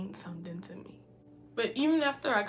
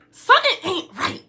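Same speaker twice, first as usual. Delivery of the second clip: mock alarmed inner voice; 11 semitones higher, 15 dB louder than usual. Inner voice, retold realization.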